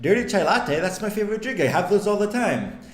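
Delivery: high, bubbly imitation of a barista girl; mock-cheery high voice of a barista who loves the drink; eager barista. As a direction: chirp it up high like a keen coffee-shop worker. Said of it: "the girl next to her was like".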